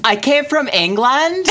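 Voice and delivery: put-on high, slowly drawled Valley girl voice. Niece claiming to come from England, mock Valley girl. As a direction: drag the vowels, Valley girl style. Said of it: "still a Valley girl".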